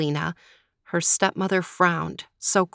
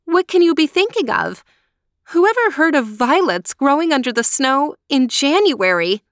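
high, louder pleading protest; pleading cry; 7 semitones higher, 5 dB louder than usual. Acted. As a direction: plead in disbelief, pained. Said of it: "cried poor Marushka".

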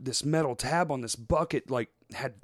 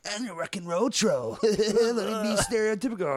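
cheesy rock-and-roll troll voice, higher; mock rock troll; 7 semitones higher than usual. Mocking the Trolls sequel character in a cheesy rocker voice. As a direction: ham up a cartoon rocker troll.

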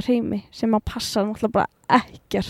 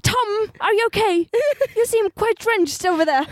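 much higher, sugary British concern; posh British Helga; 9 semitones higher than usual. Pretend. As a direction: fuss over drenched Tom in a high British voice. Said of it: "Mætir Helga".

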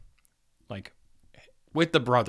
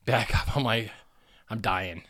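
ordinary talk

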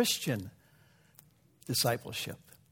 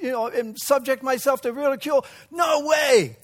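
much higher and louder, indignant prince; outraged shout; 12 semitones higher, 7 dB louder than usual. Acted. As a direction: shout down the insult to his royal pride. Alexander outraged at the request.

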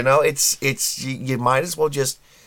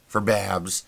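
normal talking voice

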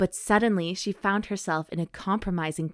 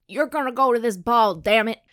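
louder, commanding Southern father; bossy rancher father; 4 semitones higher, 6 dB louder than usual. Retold or imitated. Rancher dad orders his daughter to the ball, loud bossy voice. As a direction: lay down the law like a ranch dad.

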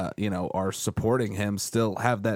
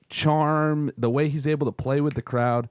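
normal talking voice

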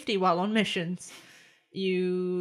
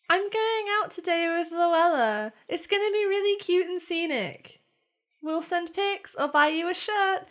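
higher, lightly voiced excited friend; bubbly friend's text; 7 semitones higher than usual. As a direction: read it like a giddy message. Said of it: "The first message says".

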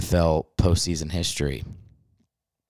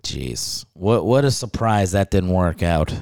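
normal talking voice

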